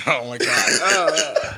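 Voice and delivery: slow, drawn out through laughter; laughing. Real, not acted. Laughing at the remark.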